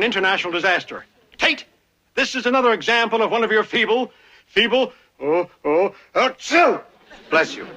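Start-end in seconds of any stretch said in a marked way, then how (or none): 5.6-6.8 sneeze. Acted. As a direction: sneeze mid-sentence. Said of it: "Got my cold back again".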